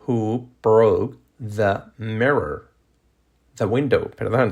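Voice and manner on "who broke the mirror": very slow, word-by-word question; slow model question. Shown as a demonstration. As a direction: separate each word for learners. Same question, slowed for learners.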